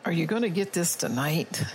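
ordinary talk

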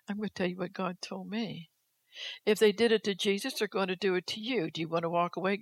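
ordinary talk, nothing marked

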